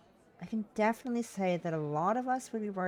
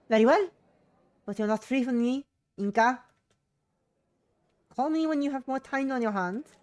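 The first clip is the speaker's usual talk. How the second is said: high, loud mum voice; raised-pitch motherly command; insistent mother; 9 semitones higher, 10 dB louder than usual. Acted. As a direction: lay down the law to your daughter. Mother signs off the phone call.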